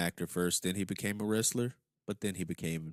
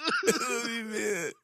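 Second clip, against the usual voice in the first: mock crying; 14 semitones higher than usual. Joins the mock crying bit.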